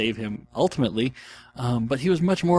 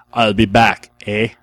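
louder Terminator line with a Canadian eh; 8 dB louder, slower than usual. Pretend. Fan showing the Canadian Terminator trick, loud Canadian accent. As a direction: boom the catchphrase, add a Canadian "eh". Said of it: "So it'll be".

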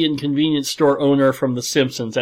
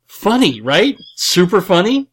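sarcastic; 8 semitones higher, 6 dB louder than usual. Acted. Sarcastic about horse murder play.

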